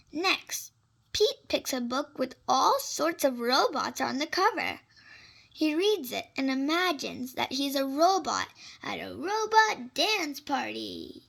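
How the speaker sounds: ordinary delivery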